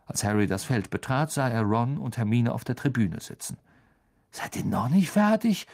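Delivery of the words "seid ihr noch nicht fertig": incredulous. A narrator's reading. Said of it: "rief Ron ungläubig".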